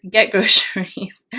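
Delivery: amused at tripping over her words; laughing at herself. Real, not acted. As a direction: giggle through the correction. Laughs at her own slip.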